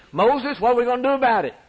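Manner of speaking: begging for help with poison in the veins; pleading Israelites. Retold or imitated. Snakebitten people begging Moses for help, fast and frantic.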